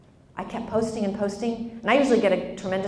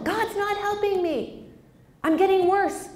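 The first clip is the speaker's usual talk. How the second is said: hammed-up high-pitched grievance; mock complaint; 10 semitones higher than usual. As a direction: wail it high as a let-down believer. Voices people leaving the church.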